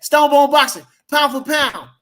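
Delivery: loud, high hype call of the channel name; shouted sign-off. Real, not acted. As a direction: announce it like a ring call.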